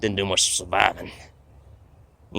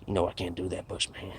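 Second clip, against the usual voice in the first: serious alien; 10 dB quieter than usual. Retold or imitated. Alien tells Bush he can't take him home, quietly serious. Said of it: "he gets real serious and he looks at me".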